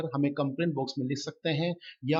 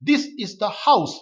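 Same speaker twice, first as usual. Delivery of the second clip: slow and louder, each word set apart; slow dictation reading; 3 semitones higher, 6 dB louder, much slower than usual. Shown as a demonstration. Teacher reading the example to students, slowly and loudly. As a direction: read it out like dictation. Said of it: "teacher reads the example sentence".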